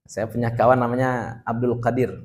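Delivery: ordinary talk, nothing marked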